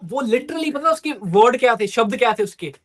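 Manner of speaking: ordinary talk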